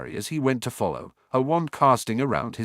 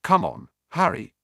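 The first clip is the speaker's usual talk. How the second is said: sharp raised bark; 6 semitones higher than usual. A narrator's reading. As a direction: snap it out urgently. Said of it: "Ron barked".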